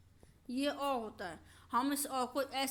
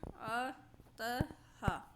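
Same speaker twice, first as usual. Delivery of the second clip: drawn-out word; much slower than usual. Shown as a demonstration. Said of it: "अतः को हिंदी में कैसे लिखेंगे".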